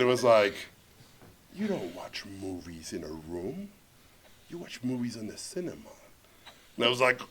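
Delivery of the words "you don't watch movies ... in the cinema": low, soft, offended reply; offended hush. Retold or imitated. Host corrects a guest who insulted his screening room, quietly offended. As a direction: correct them quietly, deeply affronted. Offended host's reaction, retold.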